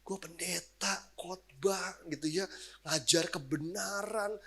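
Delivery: soft slow remorse; guilty hush. Retold or imitated. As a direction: say it slowly and quietly, ashamed. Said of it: "saya tiba-tiba ngerasa bersalah loh".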